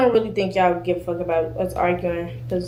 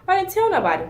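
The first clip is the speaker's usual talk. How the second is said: mocking quote; 10 semitones higher than usual. Mocking the girl's earlier denial.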